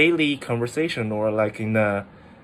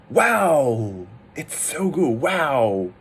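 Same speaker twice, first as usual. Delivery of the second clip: acted amazement with long falling swoops; surprised; 2 semitones higher, much slower than usual. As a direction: gush in exaggerated surprise.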